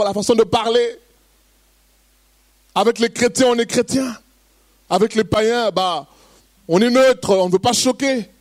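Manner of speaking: high, pressing preacher's voice; fervent preaching. Acted. Pastor warns the church against conforming, fervent preaching. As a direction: drive the point home, voice lifting.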